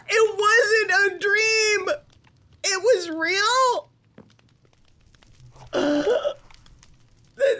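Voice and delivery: high, tearful cries of disbelief; a high-voiced character choking up at a reunion; overjoyed young character.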